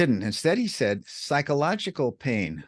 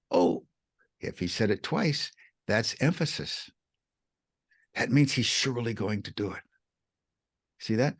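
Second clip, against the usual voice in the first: ordinary talk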